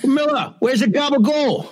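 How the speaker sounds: high, laughing mock Jersey Italian; Jersey Italian accent. Friend riffing on Jersey, a laughing mock Jersey accent. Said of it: "New Jersey accent".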